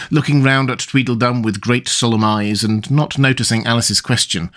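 plain reading voice

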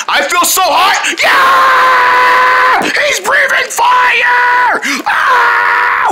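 high screaming alarm; burning-mouth panic. Acted. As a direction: shriek as the heat hits. Mouth catches fire from the spice.

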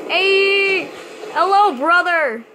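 loud high hail to a passing player; hey brother, friendly yelled greeting; calling out. Real, not acted. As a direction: shout a cheery greeting across the water.

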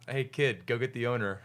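usual speaking voice

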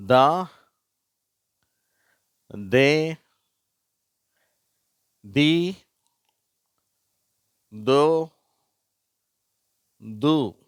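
teacher modelling syllables; syllable reading demo. Shown as a demonstration. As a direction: call out each syllable clearly for pupils to repeat. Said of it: "முதல் வரிசை".

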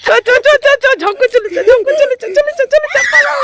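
very high, loud shoo-shoo chant. Real, not acted. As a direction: chase the animal off, laughing. Shooing a cat away.

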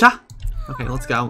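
ordinary delivery

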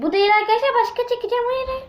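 high, rising cries of no; dismayed protest. Real, not acted. Girl protesting to viewers after drawing the wrong dare. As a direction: squeal in dismay. Drew the dare she hates.

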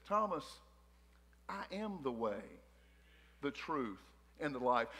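hushed, reverent reassurance; tender Jesus. Acted. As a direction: speak it gently like a promise. Jesus speaks gently to Thomas.

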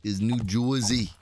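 slow, put-on New Jersey accent; mock Jersey accent. Shown as a demonstration. Contrasting Jersey with Boston, mock Jersey accent. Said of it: "And then, uh".

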